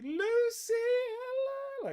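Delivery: very high sing-song hello; nan's sing-song greeting. Retold or imitated. Nan greets the grandson's partner in a high sing-song. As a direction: trill it like a delighted old lady. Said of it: "nan greets Lucy".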